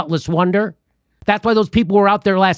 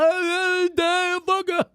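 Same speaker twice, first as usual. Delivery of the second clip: squeaky put-on voice, pitched up; high mock voice; 10 semitones higher, 4 dB quieter, much slower than usual. Mimicking the guest telling Aaron Mate off, pitched way up. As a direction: pretend to be a startled whiny guy.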